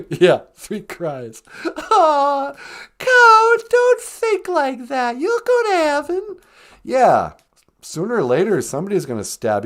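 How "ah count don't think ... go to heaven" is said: very high, sugary hostess voice; sweet greeting. Acted. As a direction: coo a hello way up high. Co-host soothes crying caller.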